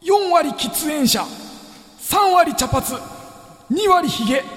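slow, loud, high raspy shout; hoarse voice. Shown as a demonstration. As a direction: bark out each line like a gruff old farmer. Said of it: "しゃがれた声で言います". (ゆっくりしゃがれた声で叫ぶ)

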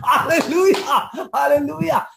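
rejoice aloud; joyful hallelujah. Real, not acted. Preacher praising after Jesus' words, joyful.

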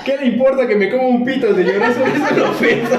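high, laughing retort; laughing mock reply. Retelling his comeback to the customer, laughing high. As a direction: laugh through the cheeky comeback. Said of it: "Y así decía".

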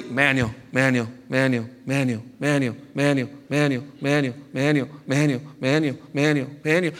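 low, soft, patient calling of a name; Jesus calling softly. Retold or imitated. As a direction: call the name gently, over and over, like a knock at the door. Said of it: "he was literally".

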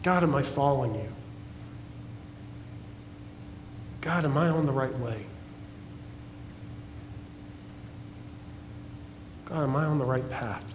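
hushed, searching questions to God; a low, uncertain murmured prayer; quiet doubting prayer. Acted. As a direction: ask it softly, almost to yourself.

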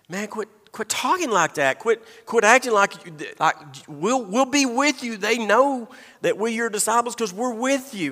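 high and loud, pleading protest; agitated disciples. Acted. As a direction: insist loudly he stays with them. Disciples protest Jesus' words.

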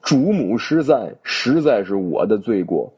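slowed, choked-up apology; a general apologizing in tears to his lady; tearful confession. A narrator's reading. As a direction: speak through held-back tears. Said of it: "哭着说啊".

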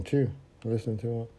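usual speaking voice

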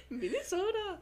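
put-on laughing, high Minnesota accent. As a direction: stretch the O like a Minnesotan. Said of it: "I can't say it without that accent".

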